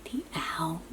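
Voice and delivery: soft storybook whisper so the kittens sleep; gentle hushed reading. Acted. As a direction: hush it like the kittens are asleep nearby. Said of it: "Gotta be very quiet for the sleeping kittens".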